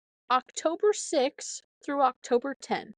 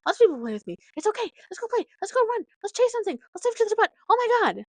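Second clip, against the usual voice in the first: much higher, eager rapid pleading; hyper dog voice; 12 semitones higher, 7 dB louder, much faster than usual. Retold or imitated. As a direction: squeal it like a dog begging to play. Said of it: "And Freya's like".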